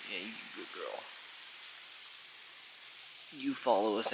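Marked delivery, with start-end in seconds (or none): 0.1-1.0 soft, low praise; tender praise. Real, not acted. Owner softly praising her frightened pet. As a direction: murmur it lovingly to her.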